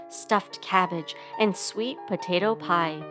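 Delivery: usual speaking voice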